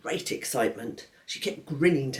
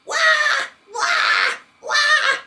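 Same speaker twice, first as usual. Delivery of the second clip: much higher, loud wailing cry; baby's yell; 9 dB louder, much slower than usual. Acted. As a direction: bawl like a wet baby. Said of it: "he shut his eyes and yelled".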